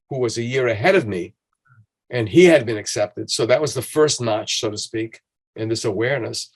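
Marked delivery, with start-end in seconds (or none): none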